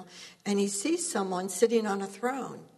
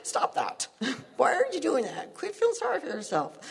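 a hint of a stern God, rising higher; 6 semitones higher than usual. Voicing God telling her to quit self-pity, mildly stern. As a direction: scold gently as God would. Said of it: "that's how he talks to me too".

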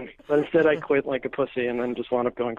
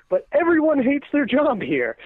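raised complaint; 10 semitones higher, 3 dB louder than usual. Retold or imitated. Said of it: "I said this word for word".

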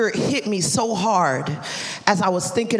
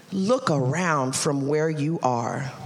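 voice of God; 6 semitones lower, 4 dB quieter, slower than usual. Acted. God speaking, deep slow voice.

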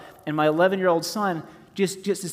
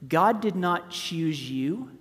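ordinary talk

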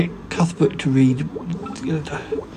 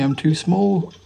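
ordinary talk, nothing marked

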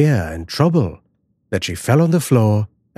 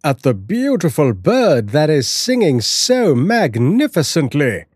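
delighted husband; 6 semitones higher than usual. Acted. Husband admires the bird.

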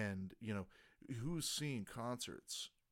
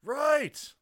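shouted answer; 17 dB louder than usual. Acted. Answering cheerleader call.

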